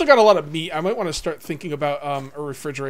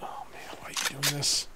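hushed, despairing plea; dismayed whisper; 3 semitones lower, 10 dB quieter than usual. Real, not acted. Pleading with teammate Cage in a hushed whisper. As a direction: breathe it out in dismay.

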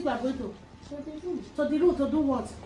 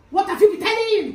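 high, loud interrogating shout; angry demand; 7 semitones higher, 10 dB louder, faster than usual. Acted. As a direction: yell the question, demanding an answer. Mother demands an answer, escalating.

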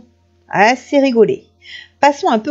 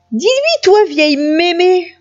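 high, exasperated demand; 8 semitones higher, 3 dB louder than usual. A narrator's reading. Chaperon noir demanding grandmother's support, exasperated. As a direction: snap it out pitched way up.

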